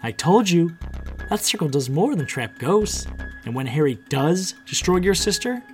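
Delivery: high, triumphant explaining; villain's gloating. A narrator's reading. Villain revealing her plan to bring magic back, gloating. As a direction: lay out your plan with relish.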